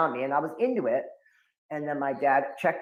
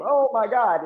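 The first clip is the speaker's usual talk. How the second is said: mock shocked cry; 8 semitones higher, 6 dB louder than usual. Dad's shocked reaction.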